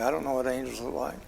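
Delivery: a quick hushed admission to himself; quiet aside. Real, not acted. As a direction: mutter it quickly as a side thought. Quiet quick self-aside repeat.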